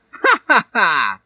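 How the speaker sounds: high, loud villain laugh; mocking laugh. A narrator's reading. Mystique laughs at Hiei left at the altar. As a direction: cackle at the jilted groom. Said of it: "laughed Mystique".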